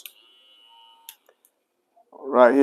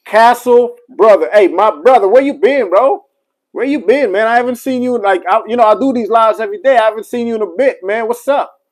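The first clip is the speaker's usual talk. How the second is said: excited greeting; 7 semitones higher than usual. Real, not acted. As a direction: hype up a regular who just showed up. Greeting a viewer joining live.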